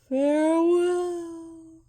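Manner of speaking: high, slow farewell; slow, high drawn-out ghostly goodbye; ghostly call. Acted. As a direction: call farewell as you fade away. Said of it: "he called".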